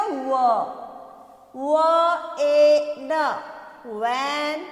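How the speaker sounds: व ए न very slow and separate, blended into वैन; letter sounds. Shown as a demonstration. Teacher showing what V becomes in Hindi, व ए न sounded out slowly. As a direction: stretch out व ए न, then join it as वैन. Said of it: "Hindi में वी का क्या हो गया".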